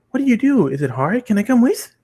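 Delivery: high, bouncy and eager; an excited pony pestering with questions. Acted. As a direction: beg to tag along, bright and quick.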